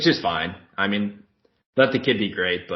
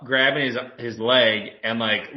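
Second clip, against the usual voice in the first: ordinary talk, nothing marked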